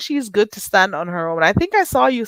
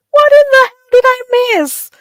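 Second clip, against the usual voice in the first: much higher, loud disbelieving cry; shocked outburst; 9 dB louder than usual. Real, not acted. Host shrieking at a shocking chat comment.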